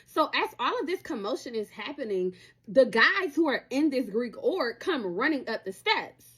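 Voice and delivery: usual speaking voice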